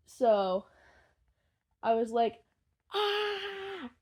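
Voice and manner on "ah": high muffled squeal held back; quiet scream. Retold or imitated. Recalling holding it in at the cinema, hushed high squeal. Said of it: "I was quietly screaming".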